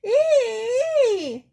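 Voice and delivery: very high, loud scared squeal; hammed-up frightened shriek. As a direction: squeal in terror, ending in a long eee. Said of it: "but continued to squeal".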